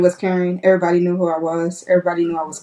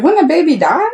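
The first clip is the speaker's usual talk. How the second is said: high, louder disbelieving question; retold alarm; 10 semitones higher, 4 dB louder than usual. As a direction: replay your shocked question to the doctor.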